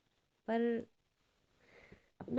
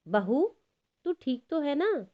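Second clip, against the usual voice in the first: calling out; 8 semitones higher, 4 dB louder than usual. Acted. Mother-in-law calls from afar.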